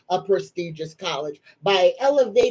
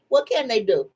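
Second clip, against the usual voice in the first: ordinary talk, nothing marked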